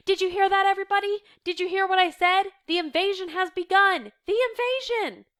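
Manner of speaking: loud, high proclamation of great news; excited. A narrator's reading. Reader voicing a helper telling everyone, wildly excited. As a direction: cry it out to the whole room. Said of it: "Meep's excited voice".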